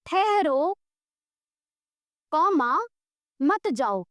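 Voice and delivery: ठहरो rising, a long pause, then मत जाओ falling; pause at the comma. Shown as a demonstration. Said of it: "comma का मतलब होता है कहीं पर थोड़ी देर रुकना". (ठहरो के बाद कॉमा पर रुककर, फिर मत जाओ)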